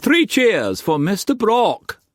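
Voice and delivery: high call for three cheers; rally everyone with a high, hearty call. A narrator's reading. Masterson leading the men in cheering Brock, rallying cheer.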